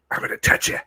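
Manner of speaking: unvoiced creepy whisper. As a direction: breathe the threat without voice. Creepy drunk fish approaching.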